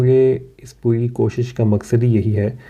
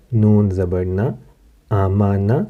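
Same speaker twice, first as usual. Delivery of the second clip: ordinary talk, nothing marked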